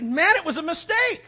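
high, loud indignant retort; copping an attitude. Retold or imitated. Driver snapping back at the honking guy with attitude. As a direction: throw it back defensively. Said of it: "you cop an attitude".

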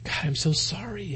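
very soft, acted apology; tearful Peter. Peter apologizing to the Lord, hushed and sorrowful. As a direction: let the apology fade to a hush. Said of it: "just tears coming down his face".

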